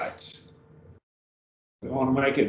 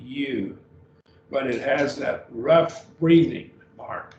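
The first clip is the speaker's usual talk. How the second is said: usual speaking voice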